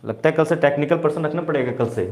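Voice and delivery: normal talking voice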